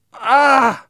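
loud, high yelp of fright; startled scream, short and loud; frightened shout. A narrator's reading. Boys grabbed by a stranger, frightened shout. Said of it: "we all shouted".

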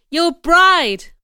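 high, emphatic outcry; crying out. A narrator's reading. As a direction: throw the words out loudly. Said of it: "I cried".

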